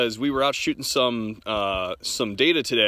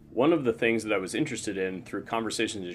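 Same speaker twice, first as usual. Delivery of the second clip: lower, measured technical read; scripted explainer read, lower and restrained; voice-over narration; 3 semitones lower, 5 dB quieter than usual. A narrator's reading. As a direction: narrate a gear test in a low, even voice.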